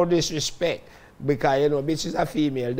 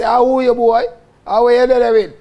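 loud, raised street challenge; shouted challenge; 6 semitones higher, 7 dB louder than usual. Retold or imitated. Squaring up to the bad man, shouting him down. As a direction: bark it out at the rival, high and loud.

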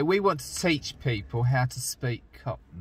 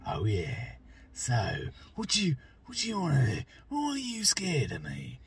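menacing Cockney; 4 semitones higher, 6 dB quieter than usual. Shown as a demonstration. Demonstrating the menacing Cockney voice, soft and threatening. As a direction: lean in and menace them quietly. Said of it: "the menacing ones".

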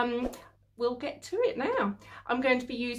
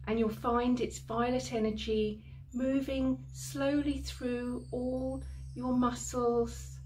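guided meditation. Acted. Healer guiding a listener through violet flame cleansing, guided meditation. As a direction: lead them calmly through the imagery, unhurried.

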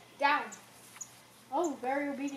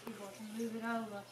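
very soft hushed aside; whispered aside; 2 semitones lower, 18 dB quieter, faster than usual. Real, not acted. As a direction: keep it hushed behind the camera. Hushed filming.

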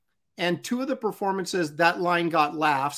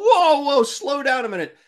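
high, loud startled whoa; surprised whoa; 9 semitones higher, 6 dB louder than usual. Real, not acted. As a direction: pull the brakes in disbelief. Stops friend's confession.

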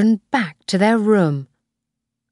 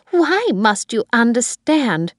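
mildly angry, higher-pitched demand; 6 semitones higher than usual. A narrator's reading. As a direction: demand it with a touch of anger. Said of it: "she asked angrily".

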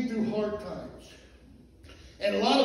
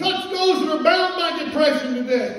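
rising, loud pulpit preaching with a call for amen; fiery preaching; 7 semitones higher, 7 dB louder than usual. Acted. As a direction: preach it at full force to the church. Preaching, congregation answers.

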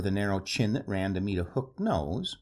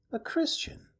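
breathy amused whisper, pitched up; soft odd whisper. Acted. The stranger, amused by the clergyman's question, whispers softly. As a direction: let it slip out as a soft, odd half-laugh. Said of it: "then laughed softly, oddly".

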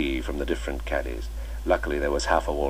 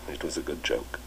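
quiet laugh; 7 dB quieter than usual. A narrator's reading. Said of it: "Chips laughed quietly".

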